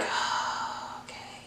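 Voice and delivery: a near-silent unvoiced "oh, okay"; breathy whispered okay. Narrator hearing her friend's threat, whispering uneasy okay. Quiet reply to news.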